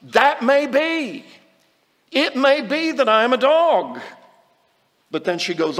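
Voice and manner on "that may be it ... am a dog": humble. Acted. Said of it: "she humbly says".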